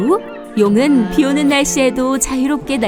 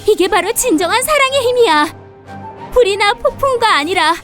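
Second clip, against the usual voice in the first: high, declaring dragon voice; triumphant dragon; 8 semitones higher than usual. Acted. Dragon tells Bao of love's power, high triumphant voice. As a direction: declare it proudly, pitched high.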